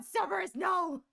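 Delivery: shouting. Real, not acted. Yelling at her pet.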